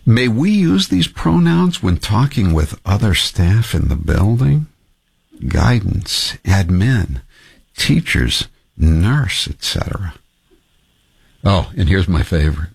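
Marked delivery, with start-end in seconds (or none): 0.1-10.1 slow, measured parody of earnest questioning; James Talarico. Acted. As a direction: read it slowly and deliberately, lifting each question's end. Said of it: "in my best James Talarico voice".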